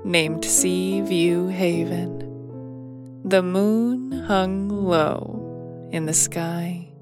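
ordinary delivery

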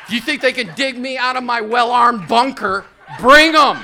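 loud mock doomsday prepper taunt; defiant shout. Taunting scam caller as doomsday prepper, loud defiant yell. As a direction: dare them to come, rising to a yell. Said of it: "So I tell him".

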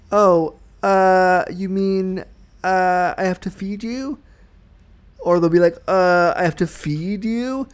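hesitant oh and uh, then FEED stressed; stall, then lean on "feed" as a rising question; imitated reluctant question hitting "feed". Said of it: "They always put emphasis on the word feed".

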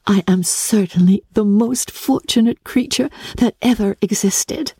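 lightly thrilled, counting her blessings; mild joyful cry. Acted. Jane to Elizabeth, mildly joyful exclamation. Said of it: "cried Jane".

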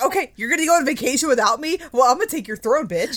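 sassy wife. Acted. Wife tells off husband who left her behind, sassy. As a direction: play the wronged wife throwing it back at him. Voicing wife seizing the throne.

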